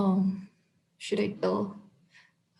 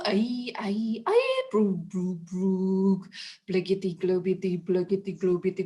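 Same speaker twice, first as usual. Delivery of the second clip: squeaky gibberish babble; silly nonsense sounds. Pretend. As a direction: babble wildly in voices. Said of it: "make silly sounds like".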